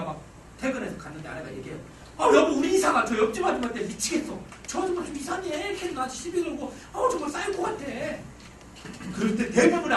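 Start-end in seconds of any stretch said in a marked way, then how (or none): 2.2-7.8 higher-pitched wife's complaint; exasperated wife acted out; wife venting. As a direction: vent to your husband in a raised pitch. Wife venting about neighbour to husband.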